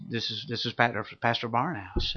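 soft voice. Retold or imitated. Pastor announcing himself at a hospital bedside, soft voice. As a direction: speak softly, as to a dying man. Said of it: "in a soft voice".

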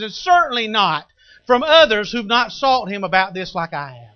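loud, high, defiant declaration; defiant preaching. Acted. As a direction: stand your ground and preach it. Preaching at full force.

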